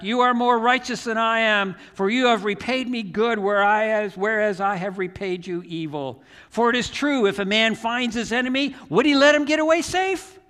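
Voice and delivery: loud, high declaration across a distance; shouting back. Retold or imitated. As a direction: call back, climbing to a high question. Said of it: "he shouted back".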